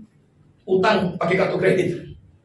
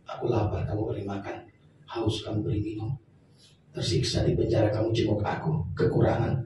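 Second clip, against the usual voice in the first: hushed, moved; 12 semitones lower, 8 dB quieter than usual. Acted. Voicing Jesus, moved to tears.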